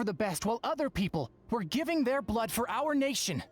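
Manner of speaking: rally everyone with rising fervor; impassioned rallying speech. Acted. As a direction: urge them on, pitch climbing as you go. Impassioned war rally before a leader.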